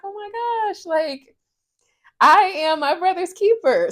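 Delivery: high, tearful rising voice. Real, not acted. Sister honoring late brother to viewers, tearful and high. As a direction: push the words out through tears. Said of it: "I'm not going to cry".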